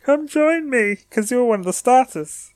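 high-pitched cartoon hero voice; Ash. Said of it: "then Ash will be like".

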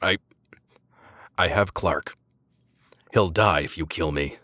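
choked, gurgling words; strangled gasping plea. A narrator's reading. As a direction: force the words out through a crushed throat. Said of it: "Words gurgled forth".

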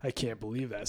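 breathy whisper of embarrassment; caught-out husband whispering; whispered disbelief. Retold or imitated. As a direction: whisper it under your breath. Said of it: "It's like".

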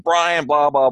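grumble-shout curses walking back; injured sailor cursing at the paramedic, mock angry; retold cursing. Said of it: "I'm just cursing and swearing".

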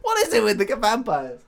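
stand-up comic. Retold or imitated. Carl's stand-up bit.

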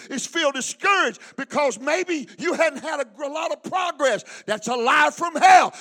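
driving, forceful exhortation; urgent preaching. Real, not acted. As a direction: build to a loud finish.

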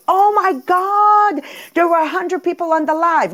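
high, stretched-out loud gasp of awe; overexcited fan, exaggerated; mock amazement. Mimics overexcitement she never voices.

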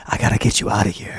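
mock whispery Batman. Imitating Batman talking to Gordon, low mock rasp. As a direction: rasp it low like a brooding hero. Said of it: "So they all talk like that".

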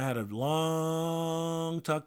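LONG stretched out on a held note; drawn-out LONG. Real, not acted. Husband recalling a talk with his wife, LONG drawn out. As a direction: hold the vowel of LONG for ages. Stressing how long it was.

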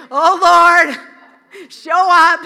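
crying out loud to the Lord; a loud pleading cry to heaven; loud desperate prayer. Acted. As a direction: call on God with all your strength. Elijah praying on his knees.

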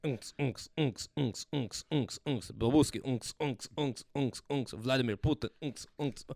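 chanted techno beat sounds; mock techno beat. Comic mimicking Euro trash club music at a Russian party, chanted beat.

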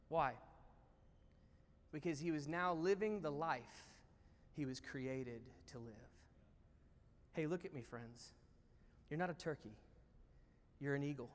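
low, soft, with weighty pauses; hushed earnest preaching. Real, not acted. Preacher tells friends who they really are, hushed and earnest. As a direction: bring the moral home quietly. Preacher drives the moral home.

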